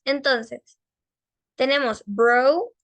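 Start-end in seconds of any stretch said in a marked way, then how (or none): 2.0-2.7 BRO drawn out slowly, O gliding toward U; long O. Shown as a demonstration. Teacher reminding students of the vowel, long-O "bro". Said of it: "O larga".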